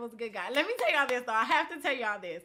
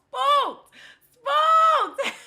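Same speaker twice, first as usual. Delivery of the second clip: high, loud laughing shout; whooping it through laughter; gleeful shout; 13 dB louder than usual. Real, not acted. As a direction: shout it out, tickled by the story. Gleeful telling, repeats the word louder.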